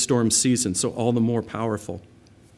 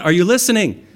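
loud, higher challenge to the crowd; loud, raised voice demanding attention; calling out; 7 semitones higher, 8 dB louder than usual. Real, not acted. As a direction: shout it to wake the room. Preacher calling out to congregation.

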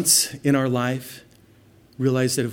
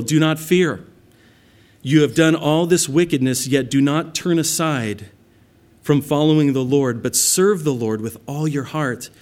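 preaching scripture; 2 semitones higher, 4 dB louder than usual. Acted. Reading Samuel's words.

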